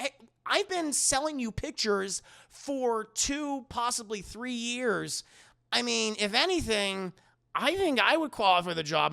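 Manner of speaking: acted indignant job applicant; earnest protest. Voicing Peter protesting to boss.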